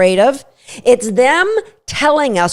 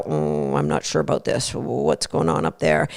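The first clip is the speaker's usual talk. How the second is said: suspicious dog grumble; 8 semitones lower, 9 dB quieter than usual. Retold or imitated. Suspicious dog eyeing another dog: low wary mutter. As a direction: lower and much softer, a wary grumbling mutter. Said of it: "start a little bit of a growl".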